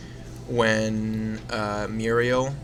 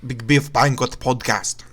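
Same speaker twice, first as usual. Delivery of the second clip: slow, louder show-title announcement; announcer voice; 5 dB louder, slower than usual. Acted. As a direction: announce the podcast name like a radio host. Announcing the show's name.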